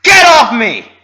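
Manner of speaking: very high, loud, slow scream. A narrator's reading. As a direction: scream at the pony who tackled her. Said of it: "Roseluck screamed".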